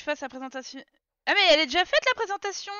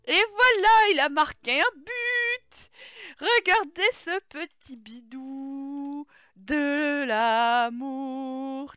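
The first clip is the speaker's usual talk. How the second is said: slow, louder, lightly hammed-up commentary; mock sports commentator; 6 dB louder, much slower than usual. Player cheering her Sim's pregnancy like a goal, slow mock commentary. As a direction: call it like a goal, stretched out.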